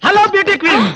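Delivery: high, eager hail; gushing high-pitched hello; excited greeting. Acted. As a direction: call out to her in delight. Greets the beauty queen, calling out.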